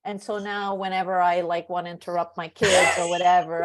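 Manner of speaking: ordinary delivery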